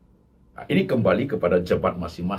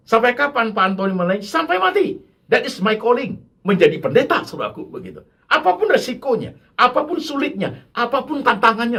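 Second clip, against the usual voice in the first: loud, high fervent preaching; impassioned pulpit cry; full-force preaching; 13 semitones higher, 5 dB louder than usual. Acted. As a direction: preach it hard to the congregation. Preaching climax.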